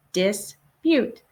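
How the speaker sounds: dispute said as dis, pause, pute; syllable by syllable. Shown as a demonstration. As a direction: lead the class through "dispute" in two beats. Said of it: "Let's say it together".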